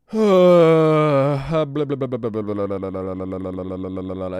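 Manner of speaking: long loud lip-flap blubber; bored lip-flap noise; lip trill. Real, not acted. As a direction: blow a loud lip trill while waiting. Long noise while loading.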